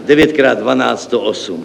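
ordinary talk, nothing marked

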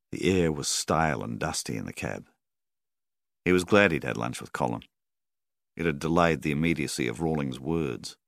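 usual speaking voice

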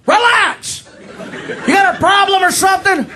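high-pitched mock bartender voice. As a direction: challenge a rowdy customer in a high put-on voice. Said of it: "Bartender starts checking me".

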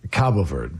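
"Cabo Verde" said the Portuguese way, KAH-bo VERD; low, careful "Cabo Verde" in Portuguese style; Portuguese pronunciation. Shown as a demonstration. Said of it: "the Portuguese pronunciation".

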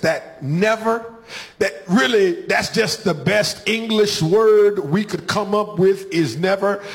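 ordinary talk

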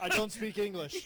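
parroting the interview in a mock Japanese accent. Host mimicking the player's post-game line, mock accent.

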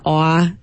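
rounded "aw" vowel, New York style; Yat vowel. Shown as a demonstration. Linguist isolating an accent vowel, rounded Yat "aw". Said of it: "sort of vowel that you will hear".

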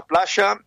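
"playa" with a sh sound, plasha; Rioplatense sh pronunciation. Real, not acted. Brazilian guest chatting from the beach, "playa" pronounced plasha. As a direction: say "playa" as PLA-sha. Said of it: "me gusta que digas playa".

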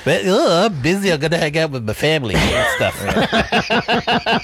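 teasing sing-song excuse; mocking excuse.

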